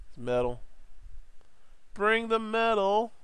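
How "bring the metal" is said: high, slow drawn-out call; playful call. Pretend. Calling for metal on the robot, playful high call.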